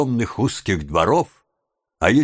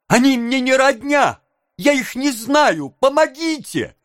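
put-on very high, panicked boy; desperate cry; 13 semitones higher than usual. A narrator's reading. Oliver struggling in the man's grip, desperate cry. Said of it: "крикнул Оливер".

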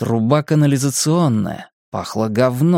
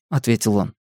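plain reading voice

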